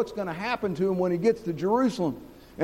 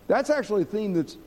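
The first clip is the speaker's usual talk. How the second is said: normal talking voice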